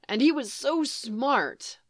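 ordinary delivery

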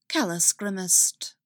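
ordinary delivery

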